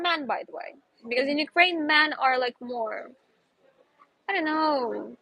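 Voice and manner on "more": ordinary delivery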